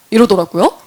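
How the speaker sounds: ordinary talk, nothing marked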